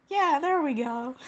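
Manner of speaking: high, loud, overjoyed relief; real high burst of triumph; emotional excitement. Real, not acted. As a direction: cry out as if it finally worked. Finds old character voice again.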